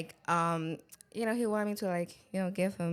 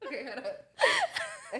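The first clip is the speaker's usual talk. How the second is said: laugh-crying, high and breathless; wiping tears, still laughing; 5 semitones higher than usual. Real, not acted. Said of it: "my tears are coming out".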